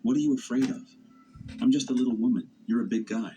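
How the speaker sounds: lightly acted accusing taunt; mock yelling. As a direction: needle him like a pushy stranger on the roadside. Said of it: "she started yelling at me".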